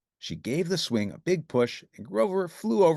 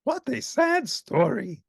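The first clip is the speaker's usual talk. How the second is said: sniffling sadly; 12 semitones higher, 3 dB louder, slower than usual. A narrator's reading. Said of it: "beginning to sniffle".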